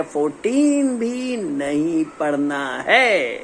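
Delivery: slow, stretched-out last item; drawn-out list finish. Real, not acted. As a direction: drag every word to a grand close. Climax of list of deleted questions. (खींचकर, धीमे अंदाज़ में)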